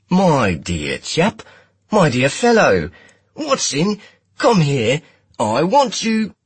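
put-on high, loud, hammy Cockney accent; a hammed-up Cockney toff; flat Cockney accent. A narrator's reading. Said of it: "smashed his round Midwestern accent into the flattest Cockney".

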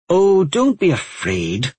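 high, drawn-out chiding; exasperated little telling-off, stretched out; scolding. A narrator's reading. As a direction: scold slowly, pitched well up. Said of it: "Alan scolded".

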